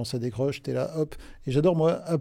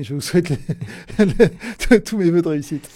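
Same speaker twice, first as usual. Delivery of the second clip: loud, higher, breaking into laughter; laughing through words; 3 semitones higher, 9 dB louder than usual. Real, not acted. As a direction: stumble over the wish, laughing. Laughing after own word slip.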